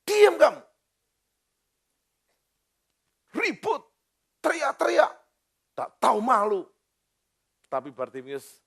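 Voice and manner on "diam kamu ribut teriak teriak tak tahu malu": high, loud scolding shout; angry rebuke. Acted. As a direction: shut the blind man up harshly. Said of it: "saya marah-marahi Anda seperti itu". (membentak marah dengan suara tinggi)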